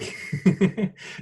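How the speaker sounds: quick giggle after a cheer. Real, not acted. Instructor after cheering on the laughter session, giggling. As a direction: giggle in short, rapid bursts.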